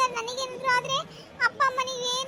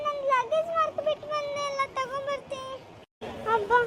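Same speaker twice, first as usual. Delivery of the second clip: ordinary delivery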